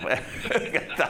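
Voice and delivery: breathy laugh through words; chuckling it out. Real, not acted. Laughing reply.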